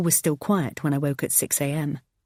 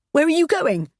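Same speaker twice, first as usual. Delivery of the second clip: high, loud panicked question; 12 semitones higher, 6 dB louder than usual. Acted. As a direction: cry it after him. Wife as husband leaves.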